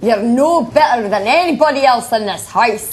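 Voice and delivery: loud performed Scottish accent; emphatic Scottish delivery. Shown as a demonstration. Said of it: "do it in a Scottish accent".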